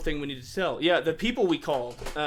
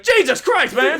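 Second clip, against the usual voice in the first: exasperated shout; 10 semitones higher, 9 dB louder, slower than usual. Retold or imitated. Retorting to a roast.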